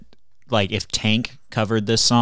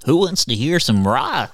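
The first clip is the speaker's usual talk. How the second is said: high, slow kiddie-show rocker voice; goofy rock frontman; 5 semitones higher, slower than usual. Acted. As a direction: hype up an imaginary crowd, drawn out. Continuing the mocked frontman impression.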